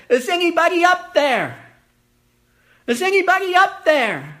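high, loud cry for help to the sky; desperate calling out. Acted. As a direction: shout up from the cliff, hanging on. Man hanging from cliff calls out.

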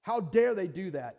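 loud, high furious complaint on the phone; angry venting. Retold or imitated. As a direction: pour out the rage to the mentors. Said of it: "I'm that angry".

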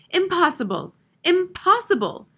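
high, loud horrified cry; aghast, pitch climbing on the repeat; filled with horror. A narrator's reading. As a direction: exclaim it in horrified disbelief. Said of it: "in a whisper filled with horror".

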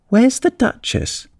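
put-on high, slow girlish question; high-pitched, drawn-out inquiry; curious Alice. A narrator's reading. Alice asks the nervous Rabbit.

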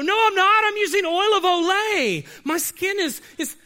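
shrill vain protest; defensive squeal about oil of Olay; mock denial. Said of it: "Some of you go".

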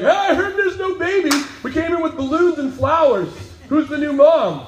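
high, loud eager visitors; excited shepherds. Retold or imitated. Shepherds crashing the maternity floor, loud and eager. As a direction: burst in like overeager guests.

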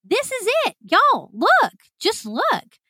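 high-pitched mock yell; retold yelling. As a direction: act out frantic pointing-it-out cries. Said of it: "yelling at them and pointing".

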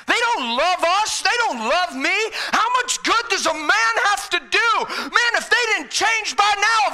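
high, loud, impassioned rant as Jesus; exasperated outburst. Acted. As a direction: shout it out, indignant and fed up. Preacher voices Jesus giving up.

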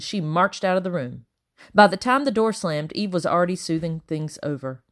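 plain reading voice

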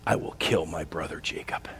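a low, voiceless whisper of murder; whispered threat. Acted. Esau whispers to himself, vowing to kill his brother. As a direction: whisper it to yourself like a vow of revenge. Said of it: "he said to himself".

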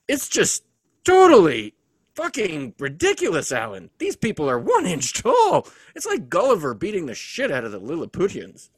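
building from firm to loud, high outrage; rising angry rant. Acted. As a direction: let the anger climb to a shout. Rant continues after gesture.

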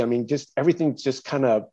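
ordinary talk, nothing marked

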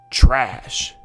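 suddenly loud mock coworker; teacher blurts the word loudly after looking around. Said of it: "say the word louder than what they said".

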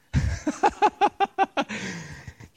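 a high burst of laughter. Real, not acted. Preacher laughing at diners on phones, high laugh.

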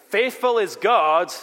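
Faithful is God rung out high, loud and slow; slow booming Faithful is God; emphatic proclamation. Shown as a demonstration. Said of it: "the Greek has an emphatic word".

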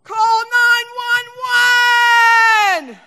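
very high, loud, long-drawn scream for help; losing it, yelling. Retold or imitated. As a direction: scream it out, stretching every word. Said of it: "lost it and I yelled".

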